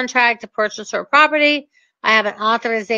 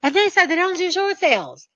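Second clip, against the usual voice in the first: complaining caller; 7 semitones higher than usual. Retold or imitated. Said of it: "people call me all the time and say".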